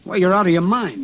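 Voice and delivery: high, loud burst of objection; outraged disbelief. Acted. Talking Frank out of seeing the witness, outraged. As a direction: protest as if he has gone mad.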